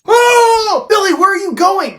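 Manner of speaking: high, loud, fast cry after him; calling after Billy as he runs off. Acted. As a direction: shout after someone running away. Yelling after fleeing Billy.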